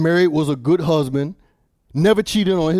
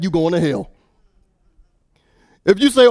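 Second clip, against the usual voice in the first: usual speaking voice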